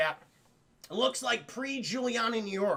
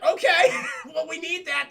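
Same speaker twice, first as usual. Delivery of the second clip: high, loud laughing protest; 6 semitones higher, 7 dB louder than usual. Retold or imitated. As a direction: laugh through the complaint. Laughing reaction retold.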